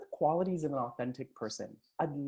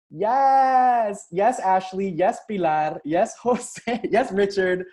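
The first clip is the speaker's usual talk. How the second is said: loud, high hype for each name; excited cheering; 5 semitones higher, 10 dB louder, much slower than usual. Real, not acted. Coach celebrating chat replies, loud excited cheering.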